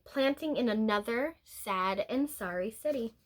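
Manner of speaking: ordinary delivery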